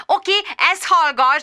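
high-pitched, eager announcement; enthusiastic. A narrator's reading. Sharon has news for Bridget on the phone, enthusiastic. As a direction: bubble over, pitched well up. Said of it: "lelkesedett Sharon".

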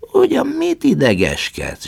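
high, loud grumbling bird voice; grumbling vulture. A narrator's reading. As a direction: nag him like a cranky vulture. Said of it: "zsörtölődött a keselyű". (a keselyű zsörtölődve Prométeuszra, magas hangon)

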